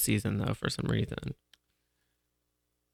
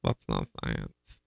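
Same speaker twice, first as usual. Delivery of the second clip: said again, much lower and slower; lower slower repeat; 8 dB quieter, slower than usual. Shown as a demonstration. Repeating herself to the host, low and slow. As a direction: drop the voice low on not.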